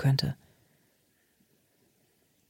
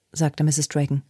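ordinary delivery, nothing marked

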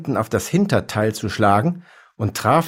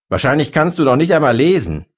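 loud, fast, higher-pitched jibe; angry taunt; 5 semitones higher, 5 dB louder, faster than usual. A narrator's reading. Shopkeeper insulting the elephant, angry taunt. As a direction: throw the insult out loudly and quickly.

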